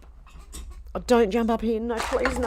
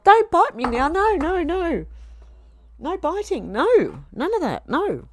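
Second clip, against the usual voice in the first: high-pitched, swooping telling-off; high, exaggerated no-no to a naughty pet; scolding a pet; 9 semitones higher, 5 dB louder than usual. Real, not acted. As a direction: yelp at the biting cat, then scold it in high swoops. Scolding the cat for biting.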